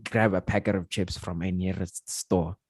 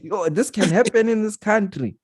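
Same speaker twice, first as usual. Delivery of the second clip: amazed; 12 semitones higher, 4 dB louder than usual. Retold or imitated. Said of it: "I'm like".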